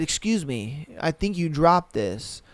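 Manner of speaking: soft, low, suave pickup line; smooth stranger. Retold or imitated. The new guy at the club returning her dignity, soft and smooth. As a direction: hand it over like a gentleman, hushed. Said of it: "he said".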